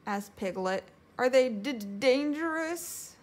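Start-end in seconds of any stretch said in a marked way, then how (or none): 1.2-3.2 higher, louder stuttering question; nervous stammer. A narrator's reading. Piglet asking if strangers are dangerous, nervous stammer.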